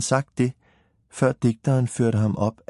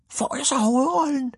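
high-pitched, eager storybook character; small character's high, excited question; eager little hero. Acted. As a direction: pipe up high, hoping for the part. Eager story hero asks for the lead.

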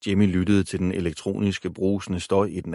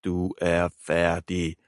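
sneering; much slower than usual. A narrator's reading. Boy sneers threat into phone, very slow. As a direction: drag out each word with scorn. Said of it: "vrængede Jimmy".

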